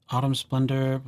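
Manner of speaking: usual speaking voice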